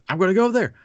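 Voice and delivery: bursting to go see the show; eager. Retold or imitated. Friend heading off to Fantasmic, eager and loud.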